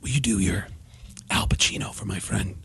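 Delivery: low, hushed, halting impression; Alec Baldwin. Actor requests an impression for his friends, hushed Alec Baldwin impression. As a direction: imitate a star quietly asking a favour. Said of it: "Alec Baldwin would come up to me and have me do impressions".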